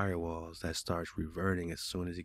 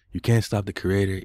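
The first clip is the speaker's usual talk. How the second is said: much louder, emphatic declaration; defiant; 11 dB louder than usual. Real, not acted. Man defying the chatbot over his creativity, loudly defiant. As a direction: belt it out in defiance.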